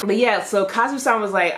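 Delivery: ordinary delivery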